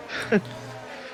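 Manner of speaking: short airy laugh; a quick helpless laugh; breathy laugh. Real, not acted. Laughs at own confusion.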